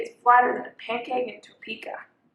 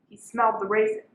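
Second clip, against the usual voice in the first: usual speaking voice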